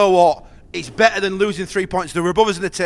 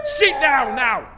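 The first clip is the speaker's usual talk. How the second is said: angry shout; 4 semitones higher, slower than usual. Real, not acted. Angry at kids during match.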